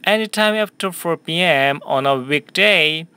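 slow, clear sentence for repeating; teacher modelling a question slowly for students; slow model reading. Shown as a demonstration. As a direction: read it slowly for the class to echo. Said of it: "한 번 더요".

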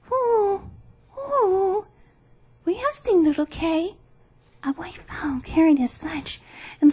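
high, hooting pigeon voice; cooing wood pigeon. A narrator's reading. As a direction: draw out the coos in a high bird voice. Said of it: "Then the wood pigeon said".

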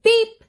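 high, loud little beep; truck horn beep. A narrator's reading. Storyteller voicing Little Blue Truck, high beeping. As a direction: toot like a small truck. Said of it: "said Blue".

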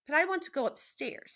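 high, loud complaint; protesting. A narrator's reading. Meg protests.

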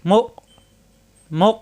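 "মো" shut firmly in the throat; "মো" stopped short; hard hamza stop. Shown as a demonstration. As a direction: say each "মো" with an abrupt glottal catch. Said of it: "হামজায় সাকিন শক্ত".

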